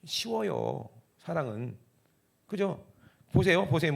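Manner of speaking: usual speaking voice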